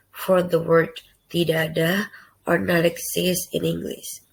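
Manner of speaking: ordinary talk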